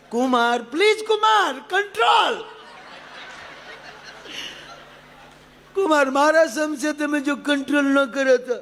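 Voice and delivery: high, laughing mock plea; pleading mother-in-law. Mother-in-law begs son-in-law on phone, high laughing plea. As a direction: beg him through a half laugh.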